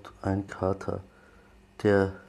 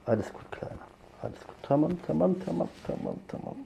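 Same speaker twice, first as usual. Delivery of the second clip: calm the frightened kitten; 8 semitones higher, faster than usual. Real, not acted. Soothing scratched kitten.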